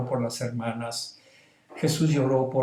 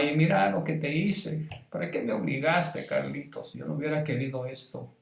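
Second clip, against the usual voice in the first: madre llorando arrepentida; 5 semitones higher, 3 dB quieter than usual. Retold or imitated. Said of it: "Y mi mamá".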